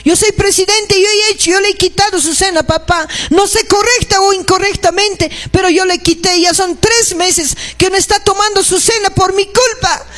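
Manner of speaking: earnest acted prayer of repentance; fervent prayer. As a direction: pray it out as a guilty church president. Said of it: "Llora cuando tu hermano se disciplina".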